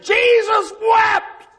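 slow, loud blurted proclamation; slow desperate shout. Retold or imitated. Preacher with a blank mind shouting to the crowd, slow loud blurt. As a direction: grab the only verse and shout it.

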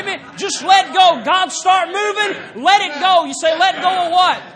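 high, loud, hammering revival preaching; preaching at full force. Acted. Preacher urging the church to let go, shouting. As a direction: drive it home like a tent-meeting preacher, shouting.

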